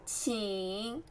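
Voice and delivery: 请 drawn out, low and dipping; third tone. Shown as a demonstration. Teacher asking learners to read after her, dipping tone on 请. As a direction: sink low on 请, then let it rise. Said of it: "third tone".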